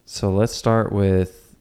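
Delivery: ordinary talk, nothing marked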